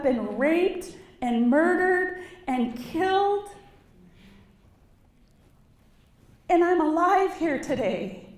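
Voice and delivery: building from firm to a loud, high, impassioned cry; rising real anger. Real, not acted. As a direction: let the outrage climb line by line until it rings out. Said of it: "I'm so mad right now".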